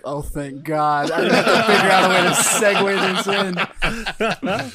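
slow, laughing relief; relieved, laughing it out; host relieved about the segue, laughing. Real, not acted. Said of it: "I was like".

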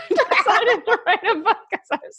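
talking through a laugh, pitch up high; amused at oneself, high and giggly. Real, not acted. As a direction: let real laughter break into the words. Laughing at her own naivety.

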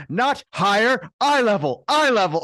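hammed-up little-man yelling; imitating Martin Short yelling. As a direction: bark the order up high. Retold Martin Short bossing big guy.

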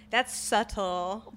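drawn-out, slow "subtle"; pointed remark. Real, not acted. Reacting to a friend's sister's hints, pointedly drawn out. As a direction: stretch the words out pointedly. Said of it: "Why you say it like that?".

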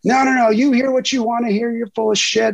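high, heated re-enacted tirade; replaying an indignant rant; retold screaming. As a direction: cut him off in a high, angry voice. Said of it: "And I start screaming".

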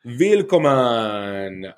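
put-on very slow, drawn-out German-accented "Willkommen"; mock German welcome. Host jokingly greets listeners to the German park, mock German accent. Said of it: "I can't say it in a German accent".

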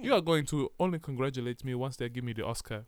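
mock Ghanaian accent; put-on Ghanaian accent. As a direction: speak with a fake Ghanaian accent. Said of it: "And I can do a dialect".

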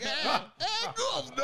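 high, botched British greeting; hammed-up British accent, sliding toward Australian; bad British accent. Friend showing off his failed British, hammed-up British accent. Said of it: "my British always turns Australian".

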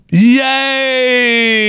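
sarcastic high squeal of joy; mock cheer. Host mocks the Facebook update with a sarcastic high cheer. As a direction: fake delight, way up high.

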